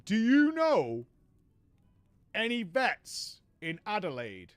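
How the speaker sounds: slow, drawn-out mock question, loud opening; Raven asking. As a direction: stretch every word like a dim bloke asking a favour. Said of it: "he asked me out of the blue".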